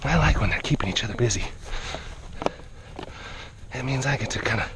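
low, soft and tender. Real, not acted. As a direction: murmur gentle approval. Soft low praise to animals.

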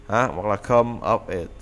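ordinary delivery

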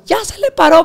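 wife's high excited shriek; excited squeal. Retold or imitated. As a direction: squeal it like she won the lottery. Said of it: "Pero bien emocionada".

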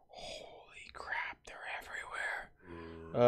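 slow, hushed whisper; scared whisper. Real, not acted. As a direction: breathe it so the mobs won't hear. Hiding from many mobs.

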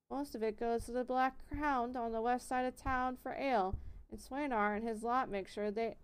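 put-on miller's country accent held throughout. Miller explaining the grain feud, country accent. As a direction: keep the country accent going while he explains. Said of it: "it's sticking now".

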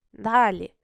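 usual speaking voice

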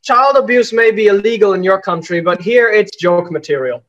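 normal talking voice

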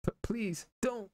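high, slow, stammering plea; mock scared plea. Streamer playing the frightened applicant, squeaky mock fear. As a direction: beg like a scared kid, hammed up. Mock pleading in game scene.

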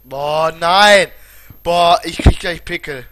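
loud, exasperated yell; furious outburst. Real, not acted. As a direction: explode in frustration. Outburst at game problem. (wütender Ausbruch)

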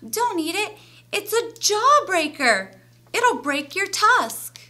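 high, urgent mouse voice; Mister Mouse squeaking. A narrator's reading. Mister Mouse warns Ganesha off the jawbreaker, urgent high squeak. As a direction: squeak a loud, high warning. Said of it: "squeaked Mister Mouse".

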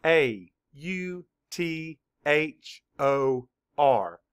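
A U T H O R spelled out letter by letter, slowly; teacher spelling A U T H O R for children. Shown as a demonstration. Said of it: "Spell it".

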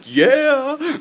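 high, loud whoop of agreement; excited yell. Retold or imitated. Retelling cheering at the repo, loud high whoop. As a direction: yell it out gleefully. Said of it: "I was like".

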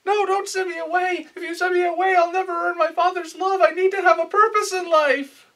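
put-on shrill anime boy pleading; desperate boy. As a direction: beg like a frantic child. Boy begging not to be sent away.

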